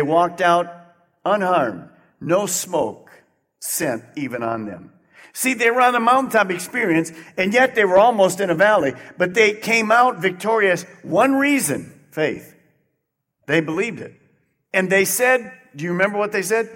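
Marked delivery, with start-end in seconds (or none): 5.3-12.5 fast, loud, rising preacher build; preaching at full force. Acted. Pastor to congregation, preaching at full force. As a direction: drive the sermon up to a peak.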